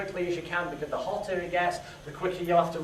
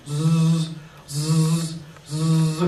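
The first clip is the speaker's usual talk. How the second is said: fly buzzing; 3 semitones lower, 3 dB louder, much slower than usual. Retold or imitated. Imitating flies buzzing.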